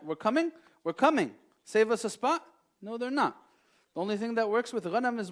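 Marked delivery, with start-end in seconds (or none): 0.0-2.4 louder mock cheerful sheep; sheep answering. Preacher mocks sheep replying, louder and eager. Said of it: "the ghanam are gonna say that".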